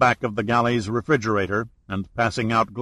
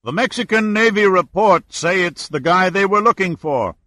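higher, louder call; shouting; 7 semitones higher, 3 dB louder than usual. A narrator's reading. Owner shouting the report to the ferry, loud call. Skipper shouting across water.

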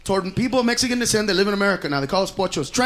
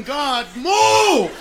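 mock shout; 3 semitones higher than usual.

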